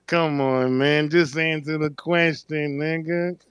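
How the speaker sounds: slow, lightly voiced [name] scolding [name]; imitating [name]. Host imagines [name] teasing [name] in interview, slow mock voice. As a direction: drawl it out, lightly mocking.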